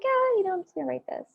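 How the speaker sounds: lightly voiced author, a high offhand Oh; breezy casual. Guest imitates the Outlander author shrugging off her book. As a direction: toss it off like it's no big deal. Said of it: "Hers was like".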